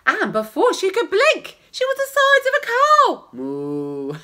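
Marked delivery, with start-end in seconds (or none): 3.3-4.1 low mock moo; silly low cow sound.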